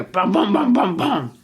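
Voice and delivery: pounding, evenly stressed "bum, bum"; imitated thunder beats. Shown as a demonstration. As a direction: drum out the thunder in hard beats. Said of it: "thunder and lightning here".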